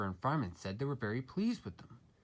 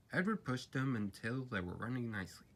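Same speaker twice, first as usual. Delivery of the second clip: ordinary delivery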